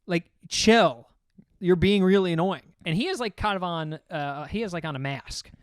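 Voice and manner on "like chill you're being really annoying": louder, slow scolding; boyfriend voice. Retold or imitated. The boyfriend to his drunk girlfriend, a loud slow scold. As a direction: tell her to chill. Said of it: "he's like".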